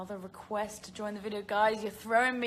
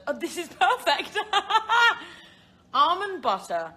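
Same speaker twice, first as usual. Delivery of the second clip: loud, high, laughing delight; 9 semitones higher, 10 dB louder than usual. Real, not acted. Host reacting to a perfect viewer question, delighted.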